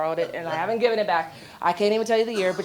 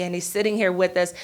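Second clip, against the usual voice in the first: ordinary delivery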